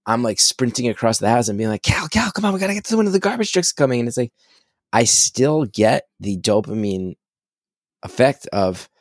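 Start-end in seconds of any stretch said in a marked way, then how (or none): 1.8-4.0 high, urgent excited call. Retold or imitated. Dad calling his son to see the garbage truck, excited. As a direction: hurry the boy to the window.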